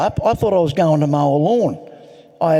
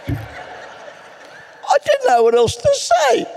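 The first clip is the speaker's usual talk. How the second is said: high, slow, laughing delivery; drawn-out amused giggle; much slower than usual. Real, not acted. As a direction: let the laugh stretch every word out. Laughing at his own reply.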